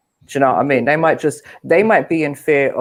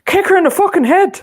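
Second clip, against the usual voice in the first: put-on high, loud Irish traveller accent; 6 dB louder than usual. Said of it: "what's the traveller accent sound like?".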